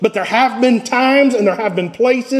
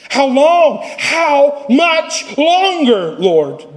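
higher, drawn-out cry to the Lord; anguished plea; 5 semitones higher, much slower than usual. Acted. Preacher voicing David's lament to the Lord. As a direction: stretch how much longer like a lament. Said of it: "David asks".

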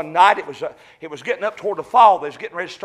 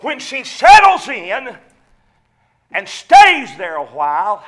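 louder, drawn-out preacher's emphasis; slow preaching; 4 semitones higher, 9 dB louder, slower than usual. Acted. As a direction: lean on every word slowly.

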